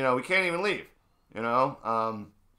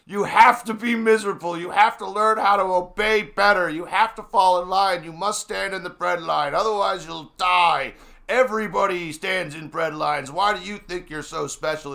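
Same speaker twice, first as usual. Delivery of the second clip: louder and higher, a hammed-up scolding shout; mock yelling parent; 4 semitones higher, 5 dB louder than usual. Parent yelling at a five-year-old, mock shouting. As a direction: bark down at the kid like a harsh parent. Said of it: "adults screaming at their kids".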